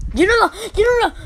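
high, loud joyful yell; excited shout. Real, not acted. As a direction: shout the name with glee. Guessing the player.

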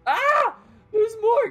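high loud startled scream; panicked shriek. Real, not acted. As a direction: shriek at the threat. New enemies appear.

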